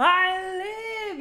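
high, drawn-out triumphant cry; mock back-from-the-dead announcement; Mushu. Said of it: "She rolls up".

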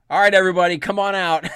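put-on loud call to the kids. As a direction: call them all out of hiding. Power guy calls kids out.

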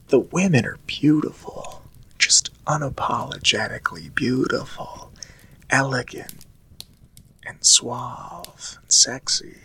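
whisper. Real, not acted. Admiring French women, whispered.

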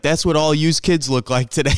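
mock the dad's Chicago Italian English rant; loosely imitated Chicago Italian accent, griping about kids. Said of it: "very deep Chicago Italian broken English".

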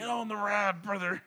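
high, pumped wrestler hype voice; Hulk Hogan impression. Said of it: "the Hulk Hogan land".

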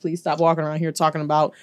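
ordinary talk, nothing marked

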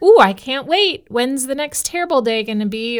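mock eagerness. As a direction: gush with fake enthusiasm. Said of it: "we just walk around like".

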